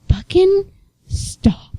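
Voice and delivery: retold irritation, high then voiceless; mock exasperation, dropping to a hush on the last word; Hannah's annoyed swearing. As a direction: mimic a fed-up scolding without volume. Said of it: "it's usually when she's annoyed".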